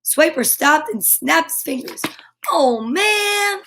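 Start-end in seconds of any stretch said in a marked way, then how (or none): 2.5-3.6 high put-on fox voice; Swiper's voice. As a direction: whine it like a foiled fox. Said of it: "That's what he says".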